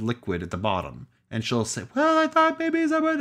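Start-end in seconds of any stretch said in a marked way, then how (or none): 2.0-3.2 high, breathy wife impression; squeaky falsetto wife voice; man doing a woman's voice. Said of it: "That is my impression of my wife".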